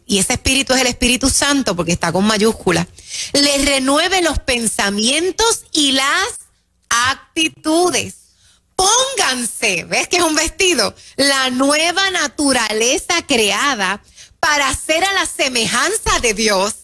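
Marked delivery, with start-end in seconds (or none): 3.3-9.8 lectura pausada y alta de la Escritura, muy lenta; lectura bíblica solemne. Acted. Lee la Escritura en voz alta. 11.2-16.7 lectura pausada y alta de la Escritura, muy lenta; lectura bíblica solemne. Acted. Lee la Escritura en voz alta.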